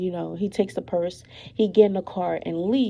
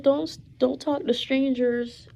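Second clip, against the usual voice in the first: mother's warning, higher and louder; 6 semitones higher, 3 dB louder than usual. Retold or imitated. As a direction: say it up high and firm, an insistent mom. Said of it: "She said".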